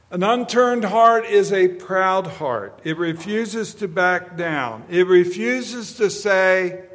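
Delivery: slow, loud, high preacher's cry; booming sermon delivery; full force preaching. Acted. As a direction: hammer each line home to the congregation.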